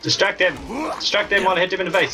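high, fast shouted order; urgent shout. Real, not acted. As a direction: yell at your teammate mid-fight. Calling to teammate in fight.

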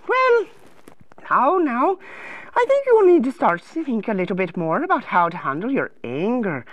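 lilting, gently concerned advisor voice; sing-song storybook helper; fussing counselor. Acted. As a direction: coo at a troubled little king.

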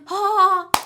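loud, high scornful bark of a laugh; Gülçin's gloating "Ha!". Retold or imitated. Friend mocking the speaker's lawsuit news with a loud scornful ha. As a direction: imitate a gloating snort at bad news. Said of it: "Gülçin böyle yaptı".

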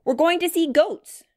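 high, loud, gleeful voice; excited child. A narrator's reading. Grace hops off the gate, excited about goats. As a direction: sing out your eager news.

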